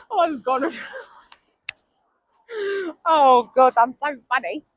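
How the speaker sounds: ordinary talk, nothing marked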